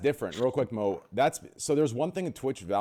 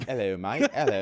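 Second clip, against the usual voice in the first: put-on British accent. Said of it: "I love accents".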